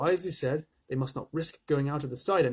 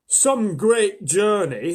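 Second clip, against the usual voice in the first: very slow, louder and higher worn-out centipede; exhausted traveller sighing it out; weary centipede; 6 semitones higher, 9 dB louder, much slower than usual. A narrator's reading. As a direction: drag the words out like a limping bug. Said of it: "the centipede said, limping".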